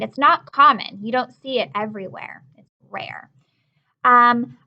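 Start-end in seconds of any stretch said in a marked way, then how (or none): 2.6-3.3 whispered, as if sharing a secret. Shown as a demonstration. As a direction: breathe it out in a whisper. Teacher stresses a vocabulary word.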